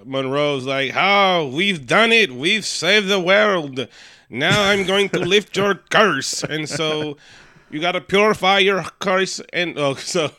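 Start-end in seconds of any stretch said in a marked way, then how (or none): 0.9-6.4 high, loud, hammed-up wandering accent; Monroe's put-on accent. Monroe announcing they saved the world, grand fake accent. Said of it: "I don't know where my accent went". 7.7-9.4 loud, high, hammed-up accent; Monroe's put-on accent. Monroe telling the cursed man to purify, mock accent. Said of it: "I don't know where my accent went".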